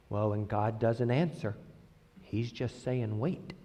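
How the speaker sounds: low, very soft mock counsel. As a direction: imitate hushed, pious advice.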